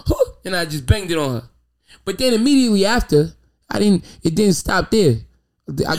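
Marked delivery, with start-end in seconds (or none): 0.1-0.1 mocking high squeak. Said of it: "She was".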